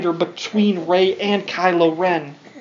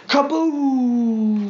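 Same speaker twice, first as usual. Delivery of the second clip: playful kaboom, slightly higher; mock explosion; 5 semitones higher than usual. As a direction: boom it out for the kids. Book's superweapon is destroyed.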